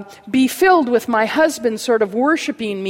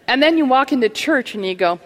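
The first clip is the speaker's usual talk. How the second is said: ordinary delivery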